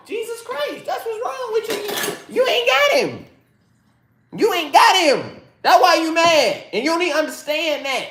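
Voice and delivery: high-pitched, exasperated rant; heated scolding. Real, not acted. Vlogger telling angry women what's wrong with them, high exasperated scolding. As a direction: drive it home in a high, strained voice.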